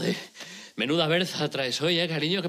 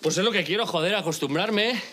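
ordinary talk

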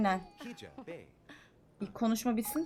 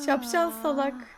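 louder insult; 5 semitones higher, 3 dB louder than usual. Acted. Insulting the Sim.

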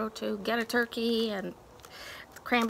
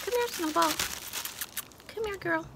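higher sing-song pet call; high voice calling Snowball to the camera; calling the cat; 7 semitones higher, faster than usual. Real, not acted. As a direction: coax the cat over sweetly. Calling her cat over.

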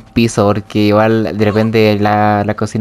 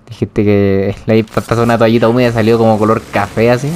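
normal talking voice

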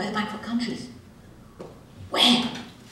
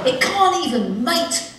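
mock outrage; 9 semitones higher, 6 dB louder, slower than usual.

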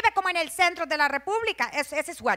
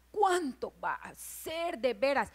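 slow, hushed wondering; soft, drawn-out busybody murmur; nosy snooping voice; 7 dB quieter than usual. Acted. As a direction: speculate furtively about the neighbor. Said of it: "no tienes que decir con esa voz".

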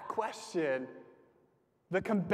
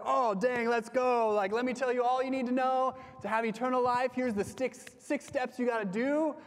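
higher, eager know-it-all pitch, mock-keen; eager rush to explain; 6 semitones higher than usual. Philip imagined jumping in with all the answers, eager and higher. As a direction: play an overeager preacher itching to unload every answer. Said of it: "Philip could have been like".

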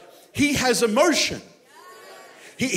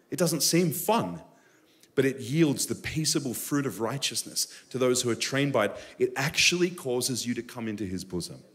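softer, lower intimate sermon; hushed earnest sermon; soft preaching; 7 semitones lower, 5 dB quieter than usual. Acted. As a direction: draw them in gently.

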